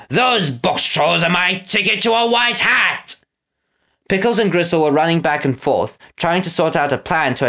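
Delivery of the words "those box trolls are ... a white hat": high, slow villain's boast; declaring villain. Acted. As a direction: announce your scheme, pitched up and drawn out. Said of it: "Snatcher declared".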